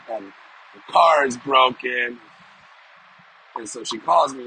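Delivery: usual speaking voice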